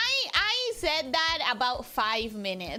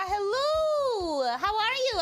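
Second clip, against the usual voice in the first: bright greeting; 9 semitones higher, slower than usual. Real, not acted. Sing-song stream greeting.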